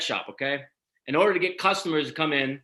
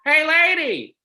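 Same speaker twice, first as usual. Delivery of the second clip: high, loud squawking parrot voice; rude parrot; 10 semitones higher, 7 dB louder, much slower than usual. Acted. Comic telling a street joke, loud high parrot squawk. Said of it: "the parrot goes".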